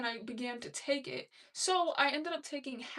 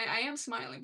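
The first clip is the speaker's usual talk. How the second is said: usual speaking voice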